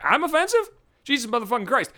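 acted-out "who, me?" indignation; mock incredulous. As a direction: shoot the pitch up on the question, then stay exasperated. Acts out reaction to being accused.

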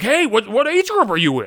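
high, loud eager question; eagerly quizzing a stranger; super excited. Retold or imitated. Another runner eagerly asking his age group, high and loud. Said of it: "super excited".

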